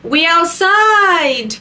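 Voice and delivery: high, long-drawn mock holler; always-outside party guy. Party man reporting his Monday evening, high drawn-out mocking call. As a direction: stretch it out like a hyped party boy. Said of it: "those men that are always outside".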